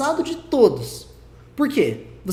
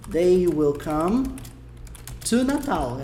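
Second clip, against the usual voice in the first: slow, careful English example; slow English demo; much slower than usual. Shown as a demonstration. Teacher reading an English example slowly to students. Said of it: "Se eu quisesse falar".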